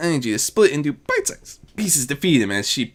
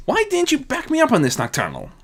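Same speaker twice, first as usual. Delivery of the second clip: higher, playful goddess voice; Azura's voice; 13 semitones higher than usual. Acted. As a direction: needle your sister playfully. Azura teases shadow sister.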